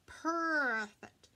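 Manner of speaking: "perfect" hit loud with a long drawn-out first syllable; "perrrfect", leaned on hard and drawn out; mocking stress on perfect. A narrator's reading. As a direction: stretch "perfect" into a loud, purring sneer. Jealous dog repeats the word mockingly.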